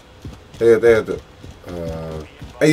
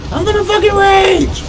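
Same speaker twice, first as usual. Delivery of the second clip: much higher, frustrated rage yell; 1 raging yell. Real, not acted. Frustrated gamer yelling at the game, raging high. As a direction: let the frustration explode upward. Yelled repeat of the threat.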